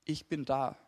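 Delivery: acted calm, gentle reassurance; reassuring. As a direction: answer the cry quietly and tenderly. Voicing God's reassuring answer to the shout.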